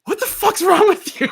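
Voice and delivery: very high, loud imitation of neighbour; acted-out incredulous yell. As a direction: shriek it in comic disbelief. Acts out neighbour's incredulous reply.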